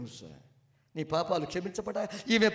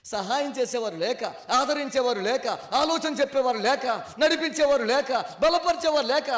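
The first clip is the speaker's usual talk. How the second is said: each line higher and louder up to a shout; building to full force preaching; 6 semitones higher, 6 dB louder than usual. Acted. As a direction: climb to a rousing call to rise.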